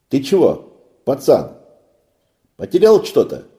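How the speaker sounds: high, loud concerned call; loud sailor. A narrator's reading. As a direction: call out to the boy. Sailor calls to boy.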